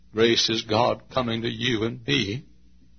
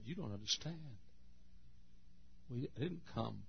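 much softer, tender answer; gentle God; 13 dB quieter than usual. Acted.

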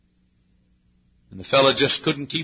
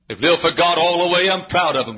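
ordinary delivery

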